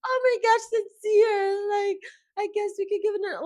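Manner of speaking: hammed-up lovesick whine; mock whiny Melanie. Mocking Melanie caving to Sincere, high and whiny.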